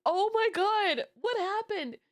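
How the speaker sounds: high, startled oh my God; shocked. Retold or imitated. Aunt just punched by nephew, high shocked cry. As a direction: gasp it out in disbelief.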